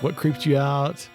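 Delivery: usual speaking voice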